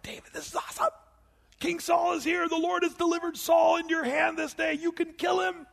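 high, strained hushed shout; excited urging. Acted. David's men urging him on in the cave, high and excited. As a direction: egg David on in an excited, high-pitched stage whisper.

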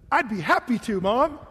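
dad modelling an eager, cheerful answer; animated. Retold or imitated. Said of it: "my dad would get all animated".

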